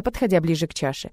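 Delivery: usual speaking voice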